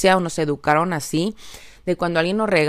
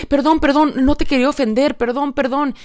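higher, fast, rushed perdón perdón; mock nervous apology; flustered apology; 8 semitones higher than usual. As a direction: apologise in a hurried panic. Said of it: "me empezó a decir".

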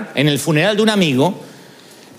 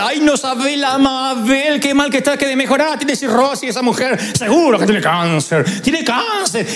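gossiping aunt; 5 semitones higher than usual. Retold or imitated. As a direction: savour the bad news like a morbid aunt. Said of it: "hablaba de enfermedad".

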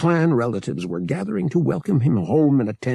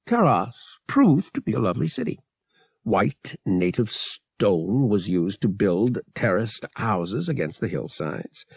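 ordinary delivery

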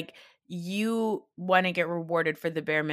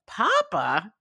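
lightly mocking a tearful plea; mock crying; 3 semitones higher, 4 dB louder than usual. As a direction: imitate someone crying out for Papa. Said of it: "She's crying".

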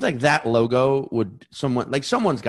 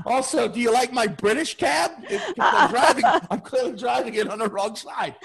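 delighted cabbie voice; 10 semitones higher than usual. Retold or imitated. Imagined cabbie greeting a newcomer in a high laughing shout. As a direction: play the overjoyed cabbie, half shouting. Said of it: "expected him to just be like".

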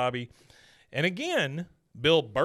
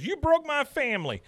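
upset Bill; 3 dB louder than usual. Retold or imitated. Bill confronts Hank, retold.

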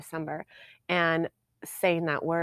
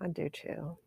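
whisper; 10 dB quieter than usual. Real, not acted. Quiet agreement, whispered.

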